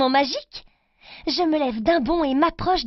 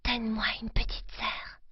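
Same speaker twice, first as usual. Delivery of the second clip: breathy secret whisper; 3 semitones lower, 10 dB quieter than usual. Acted. Girl whispering her wish to the witch doll, hushed. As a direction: whisper a wish into the doll's ear. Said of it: "je murmure".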